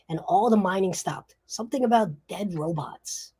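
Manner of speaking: high, protesting wiseguy; indignant crook making excuses; defensive gangster. Acted.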